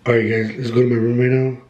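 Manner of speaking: ordinary talk, nothing marked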